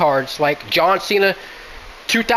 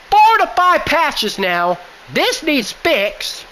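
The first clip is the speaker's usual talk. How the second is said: higher, slow and emphatic complaint; raised voice, aggravated; 6 semitones higher, slower than usual. Real, not acted. Gamer demanding a fix from the developers, voice raised in aggravation. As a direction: drag out each word in higher-pitched exasperation. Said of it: "Sorry for raising my voice".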